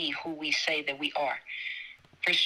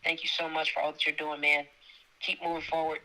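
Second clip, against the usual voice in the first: normal talking voice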